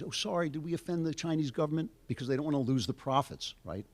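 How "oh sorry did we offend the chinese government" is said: higher-pitched mock apologetic voice; mock apology. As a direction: imitate a meek, sudden apology. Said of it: "are suddenly apologizing".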